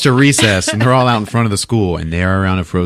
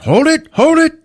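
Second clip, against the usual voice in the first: high, loud imitation of a dad calling out; mock alarmed shout; 6 dB louder than usual. Dad reacting to a bang behind the walls, alarmed mock shout.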